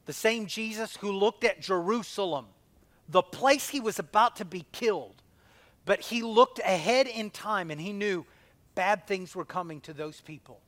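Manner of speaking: loud, fervent preacher's build; ringing sermon cadence; preaching. Acted. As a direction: drive the point home to the congregation. Preaching at full force.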